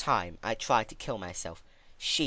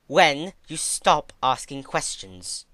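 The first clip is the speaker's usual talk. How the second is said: loud and slow, higher, cutting; mother loses patience, raised voice; 5 semitones higher, 7 dB louder, slower than usual. Acted. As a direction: snap back louder at the pestering child. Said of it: "She raised her voice at me".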